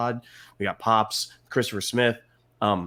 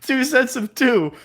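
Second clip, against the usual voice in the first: excited; 11 semitones higher, 7 dB louder than usual. Real, not acted. Hyping up the game segment.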